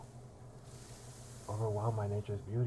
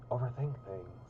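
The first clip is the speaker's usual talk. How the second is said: ordinary talk, nothing marked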